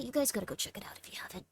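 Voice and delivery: hushed aside to the viewers; whisper. Real, not acted. Streamer whispering to viewers to check out Lena. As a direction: lean in and whisper the plug.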